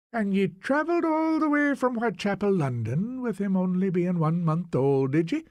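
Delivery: high-pitched Scots landlady; Scottish landlady, inquisitive. Acted. Landlady asks about the journey from Whitechapel, high and inquisitive. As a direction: question the newcomer in a high, lilting Scottish voice.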